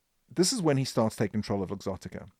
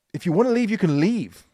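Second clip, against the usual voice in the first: higher, louder, defiant dare to the staff; raised voice, daring them to walk; defiant declaration; 6 semitones higher, 5 dB louder than usual. Retold or imitated. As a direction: throw it out as a proud challenge. Tearful boss addressing employees.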